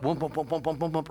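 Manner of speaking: low rhythmic whoomp sound; flat tire thumping. Retold or imitated. Driver mimics the noise heard in the car, rhythmic low thumping. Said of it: "I hear this, like, whoop".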